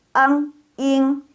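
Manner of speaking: eng, then ing, held high; built-up ing. Shown as a demonstration. Teacher shows where ing comes from, high and level. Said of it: "berasal dari i".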